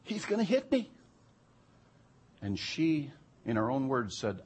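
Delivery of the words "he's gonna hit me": high, startled cry of fear; panicked thought. Retold or imitated. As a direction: voice her sudden panic at the wheel. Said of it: "But she thought".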